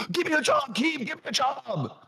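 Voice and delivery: high pleading imitation; imitating him begging Keem for a job; mock eager begging. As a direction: squeak it like a desperate fan. Mocking his past eager begging.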